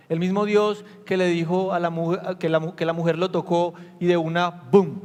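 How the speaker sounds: más alto y más fuerte, cada vez con más fuerza; predicación intensa. Acted. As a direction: proclama el poder de Dios a la congregación. (predicación apasionada y fuerte)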